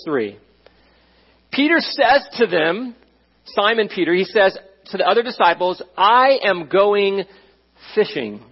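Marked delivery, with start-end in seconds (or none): 6.0-8.4 slow dramatic announcement. Acted. Peter announces he's quitting to fish.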